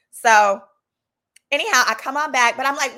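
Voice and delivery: usual speaking voice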